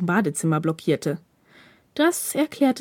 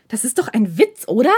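durch zusammengebissene Zähne; 3 semitones higher, 4 dB louder than usual. Acted. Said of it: "stieß er zwischen zusammengebissenen Zähnen hervor".

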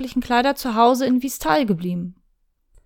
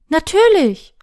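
high, bright and loud; eager agreement; 6 semitones higher, 5 dB louder, slower than usual. Acted. Shirayuki promises her teacher, high eager yes. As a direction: promise it happily.

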